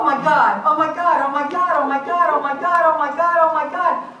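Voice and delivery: loud, high rapid-fire repetition of alarm; panicked outcry. Retold or imitated. Driver reliving the moment she hit a deer, panicked.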